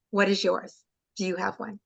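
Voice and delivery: ordinary delivery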